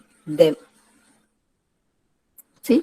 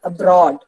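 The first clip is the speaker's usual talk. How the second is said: "Abroad" stressed on broad; light A, weighted broad in "Abroad"; stress on second syllable; 3 dB louder than usual. Shown as a demonstration. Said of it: "ബ്രോഡിനാണ് ഉച്ചാരണം". ("Abroad" എന്നതിൽ broad ഊന്നിപ്പറയുക)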